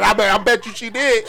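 normal talking voice

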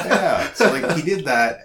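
giggling through the line; laughing. Real, not acted. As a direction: still laughing through the words. Laughing after the joke.

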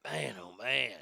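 soft, low, resigned; quiet sigh. Real, not acted. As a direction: mutter it to yourself, worn out. Quiet sigh after joke.

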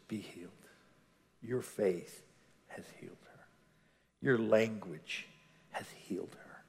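very soft, low, fading toward a whisper; hushed healing pronouncement. Acted. Jesus telling the woman she is healed, hushed. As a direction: speak it low and gently, almost under the breath.

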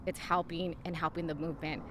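normal talking voice

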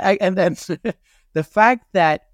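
can't hold back a laugh at the memory. Real, not acted. Laughing while telling the story.

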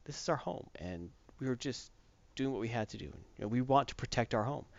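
hushed remorseful plea; soft apology. Retold or imitated. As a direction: beg forgiveness quietly. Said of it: "they apologize".

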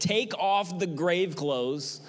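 every word spaced and weighty; Jesus' command, drawn out for emphasis; slow command. Acted. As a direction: lay down the command slowly.

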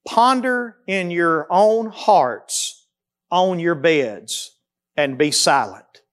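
slow, measured reading of the verse; unhurried psalm reading; slow scripture reading. Retold or imitated. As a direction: let each word sit, slowly. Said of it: "here's what he says to do next".

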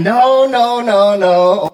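loud, high no no no; emphatic refusal. Retold or imitated. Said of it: "And I'm like".

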